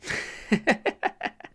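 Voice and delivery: high, wheezy chuckle; quick giggle at your own joke; light breathless laughter. Real, not acted. Laughs at own grumpies remark.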